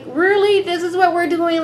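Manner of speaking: Jamal fed up with the family drama, exasperated. Retold or imitated. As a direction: sigh it out like you're done with this family. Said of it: "Jamal was like".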